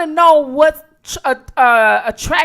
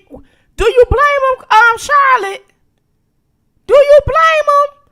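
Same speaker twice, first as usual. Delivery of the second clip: high mock indignation; 11 semitones higher, 6 dB louder than usual. Mock-indignant question to chat.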